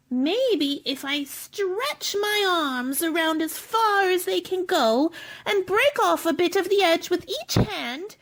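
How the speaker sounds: normal talking voice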